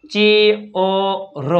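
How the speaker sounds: three separate sounds, ચે, અ, ર; syllable-by-syllable demo. Shown as a demonstration. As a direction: sound out ચે અ ર with pauses between. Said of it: "એ આઈ પછી R આવતું હોય તો એ અ".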